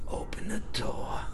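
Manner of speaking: whispered, slowed climax; soft, deliberate reveal; hushed storytelling. Retold or imitated. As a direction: drop to a whisper for the shocking bit. Hushed climax of the retold incident.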